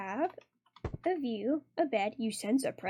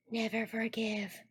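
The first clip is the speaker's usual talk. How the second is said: low hushed line; 3 semitones lower, 9 dB quieter, slower than usual. Acted. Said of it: "and she's like".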